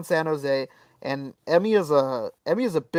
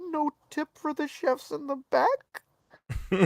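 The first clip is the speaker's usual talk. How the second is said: slow, high, softer imitation; mock indignant owner; 9 semitones higher, 6 dB quieter, much slower than usual. Owner asking diners for a tip, slow high mimicry. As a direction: stretch out a high, wheedling question.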